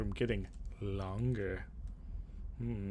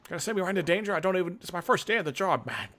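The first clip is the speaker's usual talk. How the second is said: fast, louder, higher panicky babble; nervous rush; 7 semitones higher, 5 dB louder, much faster than usual. Acted. New recruit to the advisor, a panicky nervous rush. Player character panicking about danger.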